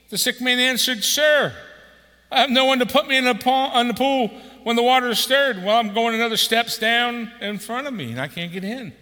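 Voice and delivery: loud, high, fervent scripture reading; booming pulpit reading; preaching at full force. Acted. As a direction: proclaim the passage to the whole hall.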